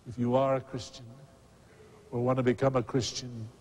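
ordinary talk

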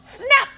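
high, loud snap effect; sharp snap. A narrator's reading. Lassos breaking, loud high snap.

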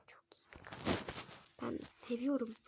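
soft whispered recommendation. Real, not acted. Kid whispering a channel shout-out to viewers.

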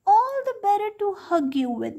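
high fake granny voice of the wolf; wolf as grandma. A narrator's reading. Wolf in grandma's bed answers the girl in a high fake granny voice. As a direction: pitch it up, sly and sweet. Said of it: "said the wolf".

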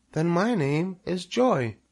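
high, slow little voice; tiny creature, unhurried and high; little spider. A narrator's reading. First spider names herself, high little voice. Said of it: "said the first spider".

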